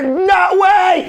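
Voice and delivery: high-pitched, emphatic brush-off; mock shouted refusal. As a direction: imitate a star shouting down a photo request. Imitates [name] refusing a photo.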